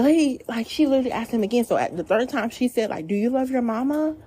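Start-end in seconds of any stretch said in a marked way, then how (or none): 3.1-4.1 snarky question. Retold or imitated. Stranger asking the son a third time, snarky. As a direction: imitate her snarky, higher-pitched question. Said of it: "in, like, a snarky way".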